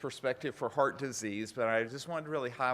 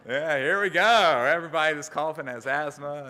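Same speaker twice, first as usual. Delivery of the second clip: loud joking; 8 dB louder than usual. Real, not acted. Joking loudly after the demo.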